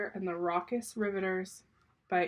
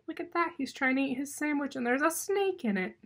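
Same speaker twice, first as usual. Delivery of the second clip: ordinary delivery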